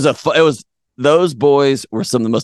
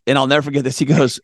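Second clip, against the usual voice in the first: normal talking voice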